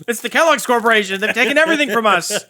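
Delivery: high, loud hammed-up indignation; mock outrage. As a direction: rant like a betrayed victim, over the top.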